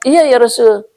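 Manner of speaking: high, urgent call of a running owner; breathless owner calling. Acted. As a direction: call out to the Prophet in a hurry. Owner voiced in story. (pemilik unta memanggil dengan tergesa-gesa)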